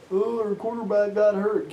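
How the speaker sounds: high, whiny mocking excuse; mock whining. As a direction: mimic a sore loser's complaint in a high voice. Mocks the other team's excuse.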